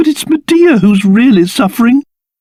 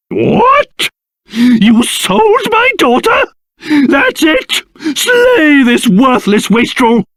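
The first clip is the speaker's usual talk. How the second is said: high-pitched, incredulous fury; outraged; 6 semitones higher, slower than usual. Acted. Father learning his daughter was sold, enraged. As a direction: explode with indignation, pitch leaping up.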